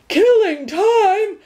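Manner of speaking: very slow, high and loud outraged roar. A narrator's reading. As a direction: roar it back in disbelief. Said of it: "roared the dog".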